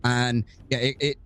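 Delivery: ordinary talk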